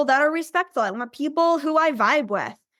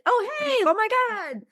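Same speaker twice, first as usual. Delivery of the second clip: gushing greeting; 7 semitones higher than usual. Retold or imitated. Gushing party invite, acted friend.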